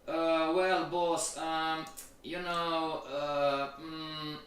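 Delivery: hesitant employee stalling for an answer; mock-sheepish hemming and hawing; acted stammering. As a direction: drag out the fillers, lost for an excuse. Acting employee stuck before his boss.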